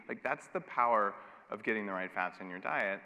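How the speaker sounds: ordinary talk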